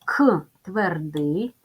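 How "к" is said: "к" as a bare hard k sound; isolated consonant sound. Shown as a demonstration. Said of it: "Називаємо приголосні звуки".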